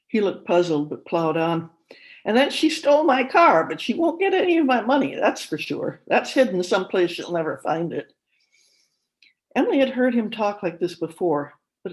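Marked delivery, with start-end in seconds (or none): 2.2-8.1 higher and louder, rushing on, then settling; suspicious old father. A narrator's reading. Father insists wife stole his car, agitated. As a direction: paranoid old man boasting of hidden money.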